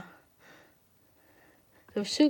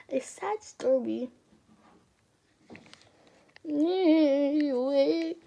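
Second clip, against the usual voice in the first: high, wobbling near-cry; near tears; 5 semitones higher, much slower than usual. Real, not acted. Reader overcome by the sad story, whimpering. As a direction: let the voice rise into a whimper. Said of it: "I'm gonna cry".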